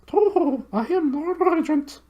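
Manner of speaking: very high hooting owl voice, far from the narrator's own; high, hooting bird voice. Acted. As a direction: hoot it like a big owl. Owl voice, laughing.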